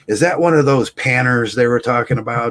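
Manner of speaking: mock-loud old man's nosy question; loud, hard of hearing. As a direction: blurt it high, like someone who can't hear himself. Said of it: "so he says it loud".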